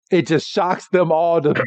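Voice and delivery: ordinary talk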